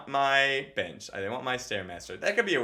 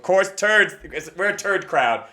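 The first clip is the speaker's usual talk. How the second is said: loud crowd riff; 4 semitones higher, 9 dB louder, slower than usual. Pretend. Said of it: "riffing to imagined crowd".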